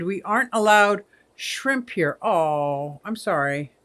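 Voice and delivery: ordinary talk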